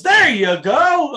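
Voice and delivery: high, loud, stretched-out praise; acted-out loud approval; shouted approval. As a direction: draw it out slowly as a big cheer. Retelling drummer's loud approval.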